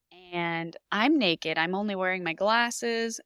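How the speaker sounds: usual speaking voice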